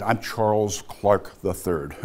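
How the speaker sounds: slow, measured waspy introduction; stiffly proper, drawn-out self-introduction. Retold or imitated. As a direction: give your full name slowly, with waspy formality. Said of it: "I gave a very waspy answer".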